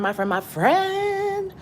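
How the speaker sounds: long high sung-out call. Acted. Vlogger calling out to her friends watching, sung-out call. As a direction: stretch it out high and loud.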